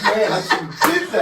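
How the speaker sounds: laughing mock regret. Mimicking son's regret, laughing.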